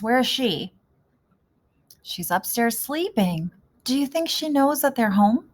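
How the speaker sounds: ordinary delivery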